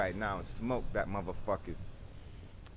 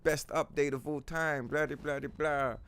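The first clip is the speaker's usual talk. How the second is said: higher, dopey fanboy gushing; mock hype fan; 5 semitones higher than usual. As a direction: parody a gullible fan. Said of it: "there'll be motherfuckers like".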